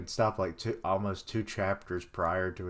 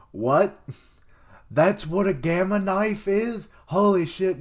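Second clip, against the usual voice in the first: loud, higher burst of disbelief; real surprise; 9 semitones higher, 8 dB louder than usual. Real, not acted. Reader discovering the gamma knife is real, loud astonished outburst. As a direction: react as if it just blew your mind.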